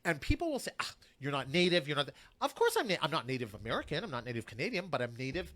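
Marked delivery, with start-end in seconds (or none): none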